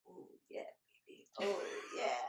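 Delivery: breathy, hushed mock-sexy moan as the knees get rubbed. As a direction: play it dirty and unvoiced, a joking moan. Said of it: "Just like that".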